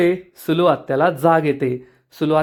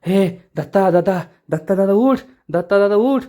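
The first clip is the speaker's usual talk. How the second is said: higher-pitched, urgent plea to wake up; frightened; 4 semitones higher than usual. A narrator's reading. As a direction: call for help in mild panic. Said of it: "Sulu आत्या खूप घाबरते".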